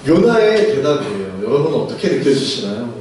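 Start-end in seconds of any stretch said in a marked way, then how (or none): none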